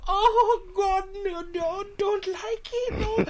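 very high, whimpering plea; mock horror. Timid lady with phone in her face, whimpering horror. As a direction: imitate a terrified lady, dragging out the words. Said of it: "it was one of sheer horror".

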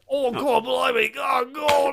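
high, hammed-up put-on accent; Hugh Jackman. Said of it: "put on Hugh Jackman's accent again".

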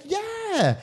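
high put-on American yeah; American accent. Going along with her date's mistake, American accent. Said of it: "But I was just like".

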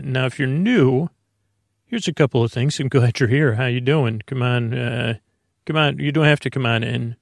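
ordinary delivery

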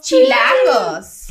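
high, hyped-up shout of the word; overexcited from coffee; too much caffeine. Real, not acted. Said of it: "Tomé mucho café, perdón".

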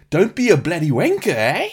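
high, loud exaggerated South African accent; swooping mock South African insult; Leonardo DiCaprio. Host imitating DiCaprio in Blood Diamond, hammed-up South African accent. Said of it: "And also dropping".

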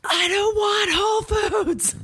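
high, slow whiny shout; mock shout. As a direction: wail it like a fed-up kid. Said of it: "And I was like".

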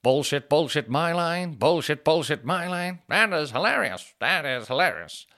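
high, loud, brash lounge-singer heckle; loud abrasive comic-character rant; Tony Clifton. Retold or imitated. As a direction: bark it out like an obnoxious nightclub act. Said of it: "then you got Tony Clifton".

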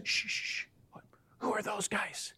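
hushed conspiratorial whisper. Retold or imitated. Tourist whispering to Peter at heaven's gates. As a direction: whisper it so the others can't hear. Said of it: "Why are we whispering?".

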